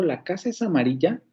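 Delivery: "¿La casa es amarilla?" rising at the end; as a question. Shown as a demonstration. Teacher repeats the question tune for the student, rising. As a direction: ask it with a clear rise. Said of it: "cuando yo te pregunto".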